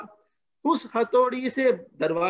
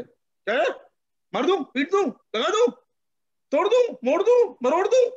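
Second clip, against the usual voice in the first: rising, louder and higher with each threat; mock angry threats; 9 semitones higher, 4 dB louder than usual. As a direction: build a hammed-up rage to a shout. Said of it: "कुछ बड़े गुस्से में". (बढ़ता हुआ नकली गुस्सा, ऊँची आवाज़ में)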